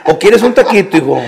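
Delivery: laughing while voicing the motherly neighbor; kind neighbor lady. Neighbor offers the hungry boy a taco, sweet and laughing. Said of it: "que estabas bien agüitado".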